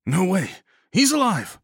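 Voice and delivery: high, loud, insistent cry; urgent shout. A narrator's reading. Rescuer contradicting the sobbing Nagel, shouting.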